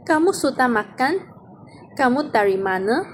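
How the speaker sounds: teacher demo of a rising question, the end of "makan" lifted; rising question tune. Shown as a demonstration. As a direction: lift the end of "Kamu sudah makan?" into a clear question. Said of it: "Nadanya bertanya".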